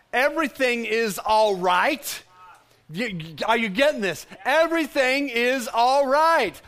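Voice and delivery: preacher driving the line home; emphatic declaration. Acted. Preacher builds the repeated declaration.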